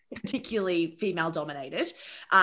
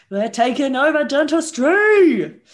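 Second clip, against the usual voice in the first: triumphant; 3 semitones higher, 9 dB louder, slower than usual. Real, not acted.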